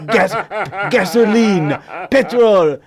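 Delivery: high, loud hammed-up French accent; English with French accent. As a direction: belt it out in desperate fake French. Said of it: "speak English with a French accent".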